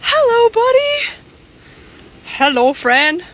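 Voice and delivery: high sing-song coo to a wild animal; cooing at a monkey. Real, not acted. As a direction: coo sweetly, slowly, to the little monkey. Greeting a monkey on the trail.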